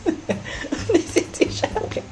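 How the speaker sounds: breathy giggling whisper, slow; whispered laugh. Real, not acted. (chuchoté en riant)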